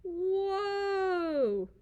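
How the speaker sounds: high, loud cry of alarm; startled whoa. Acted. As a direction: yelp as you trip. Gaston stumbles.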